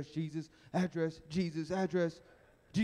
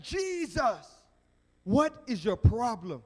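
exasperated higher cry, slowing down; livid; 3 semitones higher, 5 dB louder, slower than usual. Acted. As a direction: confront him, drawing out the question. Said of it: "the man is livid".

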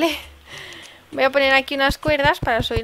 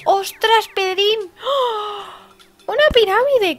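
excited discovery; 8 semitones higher, slower than usual. Real, not acted. Streamer spotting her first pyramid, thrilled shout. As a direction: yelp with glee at the find.

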